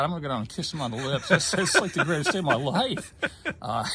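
elated, laughing through the words; laughing with joy. Real, not acted. Patient wanting to kiss his doctor, laughing with joy. Joyful retelling, laughs as he talks.

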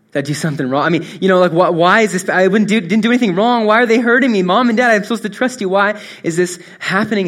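acted-out distressed appeal to parents; imagined bewildered baby. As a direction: voice a hurt child's questions, mounting in urgency. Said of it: "she's probably wondering".

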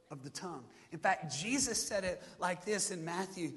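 normal talking voice

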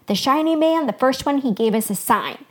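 eager shepherd remembering aloud; loud, excited recall. A narrator's reading. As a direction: blurt out the forgotten news. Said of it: "Blue Shawl said loudly".

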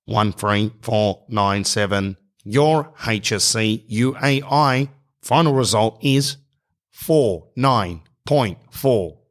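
slow, measured automated phone readout; robot. Acted. Automated line giving the exam result, robotic. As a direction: read it digit by digit like a robot. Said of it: "like, by a robot".

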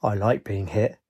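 low-key sinister relish, softly delivered; soft, quietly menacing reply with a slight smile. A narrator's reading. Man answers Ajax's threat with a sinister smile, softly sinister. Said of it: "answered with a sinister smile".

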